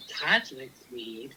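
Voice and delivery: slow, low, soft mock quote; imitating co-host. Replaying Tracy's opener amid the giggles, low hushed imitation. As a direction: intone it deep and hushed, drawn out. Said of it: "Tracy starts off this conversation with".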